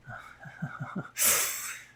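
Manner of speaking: breathy, voiceless chuckle; amused chuckle. Acted. Teasing about holding hands.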